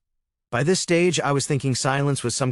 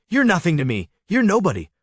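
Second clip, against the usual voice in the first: loud, higher, furious tirade; enraged teen screaming at stepfather before guests; yelling; 6 semitones higher, 5 dB louder than usual. Acted. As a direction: hurl every insult, yelling. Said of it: "began yelling at me".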